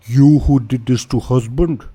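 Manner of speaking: loud, rough ogre voice; broken-grammar ogre demanding answers; ogre chieftain. Acted. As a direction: bark it like a brutish one-eyed ogre. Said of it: "and says".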